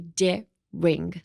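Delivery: "で、ring" with a gap between syllables; syllable-split demo. Shown as a demonstration. Teacher models daring in two parts, syllable-split pronunciation. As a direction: say "で", pause, then "ring". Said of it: "and say".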